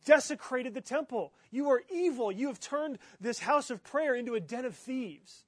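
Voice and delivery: retold shouting. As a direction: proclaim it like an angry prophet in the temple. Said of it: "And he's shouting".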